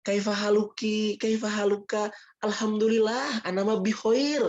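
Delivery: high, slow Arabic with a Sundanese lilt; Arabic in a Sundanese accent, mildly hammed. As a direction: imitate a Sundanese guy speaking Arabic in Egypt. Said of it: "Ketemu sama orang Mesir gitu".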